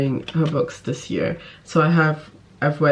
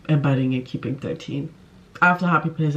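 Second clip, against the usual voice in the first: normal talking voice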